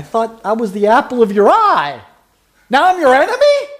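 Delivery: high, loud incredulous question; indignant surprise. Acted. Paul asks the Galatians why he is now their enemy, shocked. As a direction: act out hurt disbelief at being called enemy.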